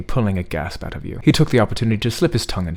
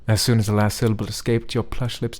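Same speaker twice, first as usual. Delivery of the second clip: ordinary talk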